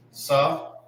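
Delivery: Sa alone, short and open; syllable demo. Shown as a demonstration. Teacher breaking down the word, isolated Sa. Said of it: "You have the".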